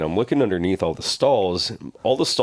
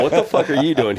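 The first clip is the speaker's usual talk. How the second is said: startled; 8 semitones higher, 5 dB louder than usual. Retold or imitated.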